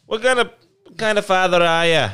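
loud, high, hammed-up Irish-ish accent; bad Irish-ish accent. Friend mocking the dad in a bad Irish accent. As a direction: ham up a bad Irish lilt. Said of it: "my accents are horrible".

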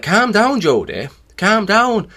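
mock dad calling out for calm; imitating worried dad. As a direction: urge someone to cool off, pitched well up. Dad urging him to calm down.

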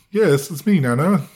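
overdone cheery yell; mock overloud greeting. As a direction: shout it like greeting a deaf grandma, as a joke. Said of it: "I didn't say it like that".

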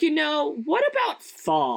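high, slow, far-off pitch; mock Persephone pitching autumn; sly goddess voice. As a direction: coax them toward fall slowly. Voicing Persephone pitching fall.

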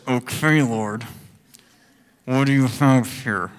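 grudging, hesitant plea with Okay drawn out; reluctant prayer. Shown as a demonstration. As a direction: pray it like you'd rather not. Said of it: "it was more like this".

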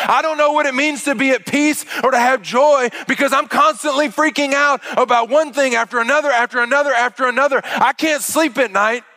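high, loud, fast frantic panic, hammed up; panicked body voice. Pastor voicing the body's panic to the congregation, frantic and high. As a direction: act out the body freaking out, high and racing. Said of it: "And your body goes".

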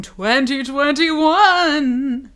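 high, loud, eerie ghost voice; haunt the words like a little ghost; spooky ghost voice. Pretend. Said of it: "like a, a little spooky ghost".

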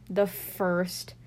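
normal talking voice

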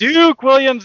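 loud, high burst on the name; excited confirmation. Real, not acted. Host confirming co-host's correct guess, loud and excited. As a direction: announce the right answer with a big jump in volume.

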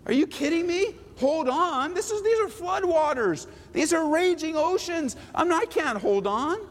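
high, mock-panicked disbelief; incredulous Noah. Noah protesting to God, high mock disbelief. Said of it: "You're like".

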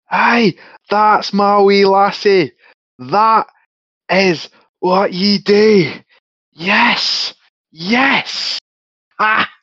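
proud Scottish warrior whooping; Scottish cheer. Acted. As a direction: cheer your wee lassie in Scottish. Player cheers as Atlas.

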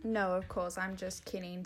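ordinary talk

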